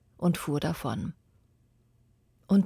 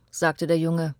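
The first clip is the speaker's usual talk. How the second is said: ordinary delivery, nothing marked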